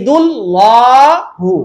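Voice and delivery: ইউরিদুল্লাহু slow, thick dark L; slow recited ইউরিদুল্লাহু; heavy L in Yuridullahu. Shown as a demonstration. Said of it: "পেশ help করলো".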